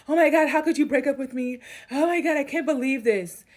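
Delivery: high, loud hammed-up pleading; mocking the ex begging not to be dumped; mock groveling. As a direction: whine like a dumped man begging. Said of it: "come groveling to them".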